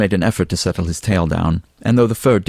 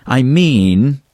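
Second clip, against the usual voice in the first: emphatic, higher and louder restart; 7 semitones higher, 6 dB louder than usual. A narrator's reading. Alan presses his point over Fabian's grin, loudly. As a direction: raise the voice to insist. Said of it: "he said loudly at Fabian's grin".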